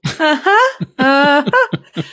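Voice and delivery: high, drawn-out doubtful uh-huh; fake-agree while biting your tongue; skeptical uh-huh. Host humoring Rob's request, high skeptical uh-huh. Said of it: "And I'm just like".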